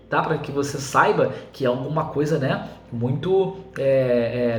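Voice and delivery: usual speaking voice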